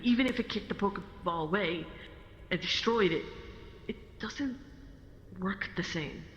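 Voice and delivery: ordinary talk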